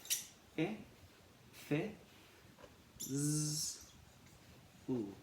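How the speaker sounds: f, i, fi, z, u said apart; sounding out fizzle. Shown as a demonstration. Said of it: "So we say".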